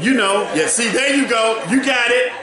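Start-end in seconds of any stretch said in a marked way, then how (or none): 1.7-2.3 laughing. Real, not acted. Preacher laughing with congregation.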